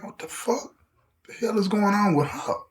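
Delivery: mock-baffled, said under the voice; hushed bafflement. Officers baffled by her, hushed imitated disbelief.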